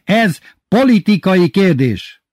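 slow, high, loud pronouncement; headmaster yelling. A narrator's reading. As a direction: shout it with grave outrage. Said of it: "üvöltött hirtelen az igazgató". (felháborodott, vontatott kiáltás)

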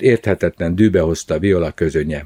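ordinary delivery, nothing marked

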